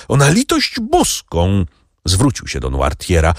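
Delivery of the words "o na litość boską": begging. A narrator's reading. Franz begs Noirtier.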